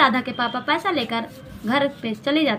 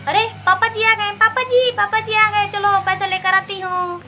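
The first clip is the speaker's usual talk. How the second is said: much higher, bright squeal of delight; excited daughter; 7 semitones higher than usual. Acted. Daughter delighted that father is home with money, much higher. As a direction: greet dad with glee.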